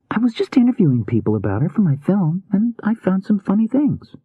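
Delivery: put-on high, girlish voice, explaining herself; defensive young woman. A narrator's reading. Rune excuses her interviews to Healy, defensive young woman. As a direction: justify it in a high voice.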